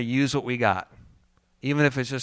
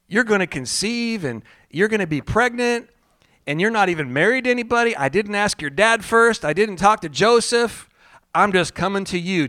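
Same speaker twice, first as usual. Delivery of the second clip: higher, louder pushy pitch; salesman Gabriel; 6 semitones higher, 3 dB louder than usual. Pretend. Gabriel breaking the news to Mary, higher and louder salesman. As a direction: sell the bad news cheerfully. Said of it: "Gabriel had some sales training".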